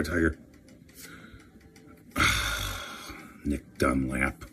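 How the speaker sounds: normal talking voice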